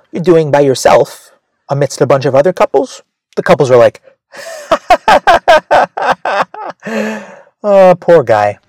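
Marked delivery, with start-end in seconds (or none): 4.4-7.2 mock high giggle. Couples laughing at loner. 7.6-8.6 mock pity. Couples pitying the loner.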